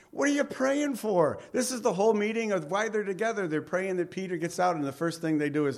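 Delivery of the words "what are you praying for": incredulous retort. Retold or imitated. Preacher mocks the doubters.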